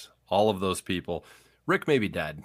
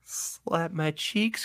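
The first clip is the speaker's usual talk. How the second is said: silly high drawn-out voice; 9 semitones higher, 4 dB quieter, much slower than usual. Pretend.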